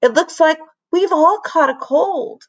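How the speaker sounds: high, chirpy announcement; lightly voiced kid; announcing kid. A narrator's reading. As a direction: pretend cheerfully. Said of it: "announced Stanford".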